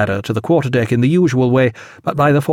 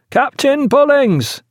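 high, drawn-out shout; stretched, carrying reply; answering hail; 14 semitones higher, slower than usual. A narrator's reading. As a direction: call the captain's name across the water.

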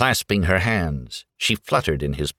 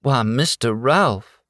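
high, slow Southern belle drawl; genteel Southern drawl; soft Southern lilt; 6 semitones higher than usual. A narrator's reading. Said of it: "soft, lilting Southern voice".

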